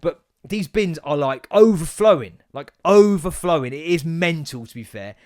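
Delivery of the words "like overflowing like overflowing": OVERFLOWING stretched out and loud; emphatic exaggeration. Real, not acted. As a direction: lean hard and slow on "overflowing". Stressing how full bins are.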